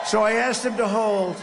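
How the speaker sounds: loud punchline; emphatic shout. Real, not acted. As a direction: belt it out for the crowd. Punchline to cheering crowd.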